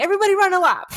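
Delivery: high, loud PE teacher call; imitated teacher's shout. PE teacher ordering the class after the fart, imitated shout.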